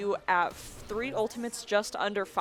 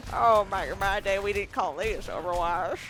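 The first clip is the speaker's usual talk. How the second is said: lightly voiced toothless old man; a hint of a grumbling old-timer. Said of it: "Very old man with no teeth".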